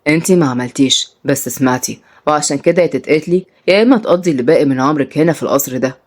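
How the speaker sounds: low, cool captor voice; controlling captor. Acted. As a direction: speak low to a captive. Salim threatens his captive.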